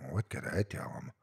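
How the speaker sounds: hushed, voiceless whisper. A narrator's reading. Survivor asking himself in dread, whispered. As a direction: breathe the question to yourself. Fearful private thought.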